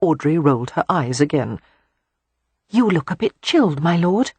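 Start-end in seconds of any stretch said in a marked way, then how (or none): 2.7-4.3 put-on higher, gentle girl's voice; kindly observing; concerned young lady. Beatrix noticing the earl is cold, gentle. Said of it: "Beatrix observed".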